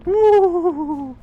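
long high wail; high loud howl. Real, not acted. Reacting to the height.

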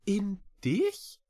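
high little cat voice, rising question; astonished. A narrator's reading. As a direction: ask back in amazement. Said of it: "fragte Echo erstaunt". (erstaunte, hohe Katerstimme)

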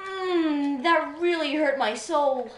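high, loud, drawn-out complaint; mock wounded whine. Player claiming a hurt soul, mock wounded wail. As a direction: groan and whine as if deeply wounded.

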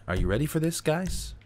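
ordinary delivery

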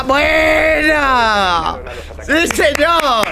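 high, fast urging shouts; excited cheering. Real, not acted. Cheering a clutch play.

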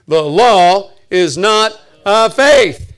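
loud, very slow, word by word; booming, spaced-out declaration; emphatic recitation. Shown as a demonstration. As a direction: drive each word home to the congregation. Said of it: "Say it".

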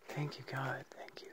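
hushed grateful whisper; whispered thanks. Real, not acted. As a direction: breathe the thanks quietly. Quiet thanks at the spot.

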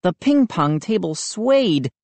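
ordinary delivery, nothing marked

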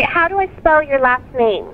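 ordinary delivery

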